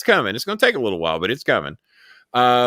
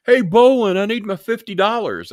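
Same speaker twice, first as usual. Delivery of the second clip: high, nagging imitation of a whiny caller; mocking the caller; 10 semitones higher, 5 dB louder than usual. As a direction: whine it out like a pushy debtor. Retells caller demanding bet money.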